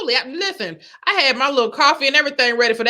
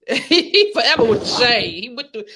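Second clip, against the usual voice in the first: ordinary talk, nothing marked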